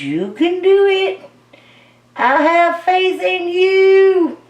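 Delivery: high, slow mock Southern drawl; playful fake Southern accent coaxing paint; random Southern accent. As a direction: cheer the paint on in a hammed-up Southern voice. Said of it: "I break out into a random Southern accent".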